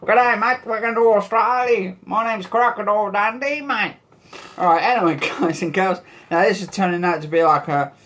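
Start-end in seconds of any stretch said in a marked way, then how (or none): 0.0-4.0 higher, hammed-up Aussie voice; cartoon Australian, pitched up; mock Australian accent. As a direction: do a comic Crocodile Dundee Australian. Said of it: "go for an Aussie".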